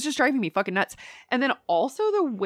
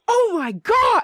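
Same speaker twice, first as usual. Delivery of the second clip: loud, high outburst of disbelief; shocked exclamation; 14 semitones higher, 8 dB louder, slower than usual. Retold or imitated. Viewer re-enacting shock at Matt's slip, exclaiming. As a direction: exclaim it, pitch leaping right up. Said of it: "I was, like, exclaimed".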